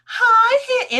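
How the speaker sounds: very high, loud gushing pet voice; cat-greeting voice. Retold or imitated. Greeting the cats mid phone call, squealed pet voice. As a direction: squeal a greeting at your pets. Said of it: "your voice immediately changes when you see the cats".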